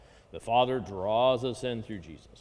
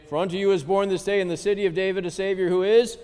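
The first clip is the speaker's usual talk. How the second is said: raised, faster herald voice; angel's announcement; 6 semitones higher, 5 dB louder, faster than usual. Retold or imitated. Teacher voicing the angel announcing the Savior's birth. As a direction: proclaim it like a bright angel herald. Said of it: "heard the angel say".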